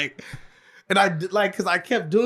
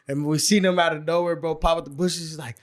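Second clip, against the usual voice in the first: ordinary delivery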